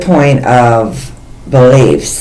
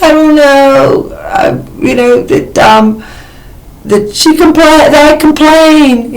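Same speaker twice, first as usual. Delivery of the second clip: much higher, slow, helpless little voice; whiny self-quote; 13 semitones higher, slower than usual. Retold or imitated. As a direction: play yourself as clueless and small. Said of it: "And I was like".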